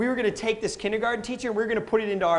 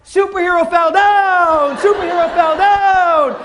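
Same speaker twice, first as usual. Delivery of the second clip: put-on kid's loud announcement; 7 semitones higher, 9 dB louder, slower than usual. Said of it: "three seconds later".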